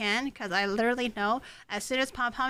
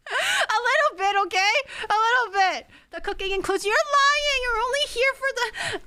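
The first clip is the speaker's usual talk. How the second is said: high, loud whiny protest; mock whining; 11 semitones higher, 5 dB louder than usual. Streamer whining at chat for teasing her, high and loud. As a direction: whine back at the teasing chat.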